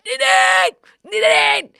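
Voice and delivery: shouting for Nadine from the toilet; shouting her name. Retold or imitated. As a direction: yell "Nadine!" to wake her. Yelling for sleeping friend while sick.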